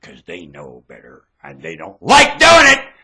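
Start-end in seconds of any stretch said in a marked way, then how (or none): 2.0-2.9 high, loud outburst; high-pitched loud insistence; sudden shout. Real, not acted. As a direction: break into a yell. Bursts into shout.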